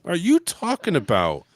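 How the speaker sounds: slow, hushed incredulous question; exaggerated stunned disbelief; mock disbelief. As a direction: draw it out as a stunned audience reaction. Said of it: "the audience felt a little bit like".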